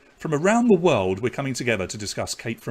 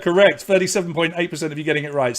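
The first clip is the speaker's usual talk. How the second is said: normal talking voice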